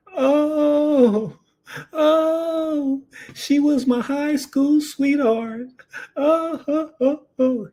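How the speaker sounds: very high, slow wailing sobs of a grieving husband. Retold or imitated. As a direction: mimic a man crying out for his high school sweetheart. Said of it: "this guy starts screaming".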